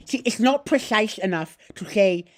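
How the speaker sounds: put-on high, loud, heavily lisped foreign accent; spitting, effortful philosopher caricature; Zizek. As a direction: slosh every s, pushing the words out. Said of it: "give me your best Zizek".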